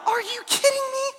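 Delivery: very high incredulous squeak; in awe. Retold or imitated. Townspeople shocked at Jesus' choice, high incredulous squeak. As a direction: squeal it in disbelief. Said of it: "people are in awe, like".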